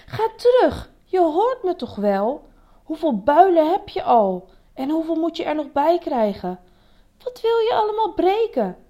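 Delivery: high, loud tirade of questions; angry scolding. A narrator's reading. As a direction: berate them with exasperated questions.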